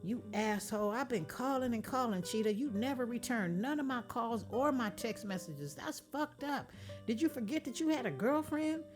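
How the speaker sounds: high, loud angry scolding on the phone; angry girlfriend. Acted. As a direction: chew him out for ignoring her.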